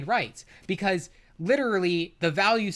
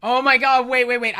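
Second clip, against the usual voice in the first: louder, higher oh my God; startled stop; sudden exclamation of remembering; sudden outburst; 7 semitones higher, 9 dB louder than usual. Real, not acted. Sudden recall.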